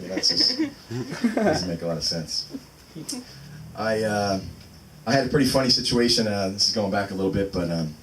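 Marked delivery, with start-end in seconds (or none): none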